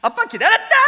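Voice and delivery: very high, loud, beaming welcome; overacted cheery greeting. Dad tells daughter he waited, hammed-up happy shout. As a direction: ham up the joy like a drama award actor. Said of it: "열심히 연기를 했어요".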